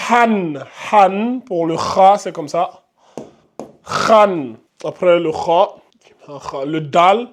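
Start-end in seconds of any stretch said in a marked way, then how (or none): 0.1-1.4 Han with a strong breathy h from the throat, pitched up. Shown as a demonstration. Teacher demonstrates Han, han from the throat. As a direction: push air from the throat for Han, han. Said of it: "ça va donner comme ça".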